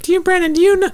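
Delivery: very high mock mother's voice; mom impression. Mom asking her son about texts, high-pitched mock mom voice. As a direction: imitate your mom in falsetto. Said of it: "My mom gets them too".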